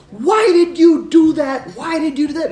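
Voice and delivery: high, angry repeated why; mock irate lady demanding answers. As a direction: confront him, upset and insistent. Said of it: "she was irate".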